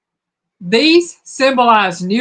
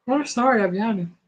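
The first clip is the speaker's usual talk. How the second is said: ordinary talk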